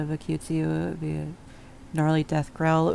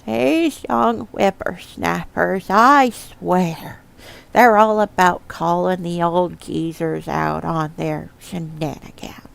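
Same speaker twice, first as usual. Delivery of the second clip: put-on old prospector; 5 dB louder, slower than usual. Shown as a demonstration. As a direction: grumble like a crusty old prospector. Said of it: "I'm not in the right voice".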